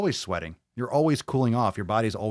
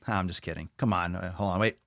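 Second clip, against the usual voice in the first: ordinary talk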